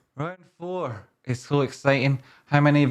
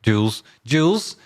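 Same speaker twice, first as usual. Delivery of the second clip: rising higher with each call of a name; 9 semitones higher than usual. Acted. Streamer calling out to a viewer named Jules, rising in pitch.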